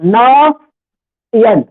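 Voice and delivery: नयन drawn out very slowly; said with pauses. Shown as a demonstration. Teacher tells pupils to say नयन pausing. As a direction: stretch नयन syllable by syllable. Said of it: "रुक के बोलो".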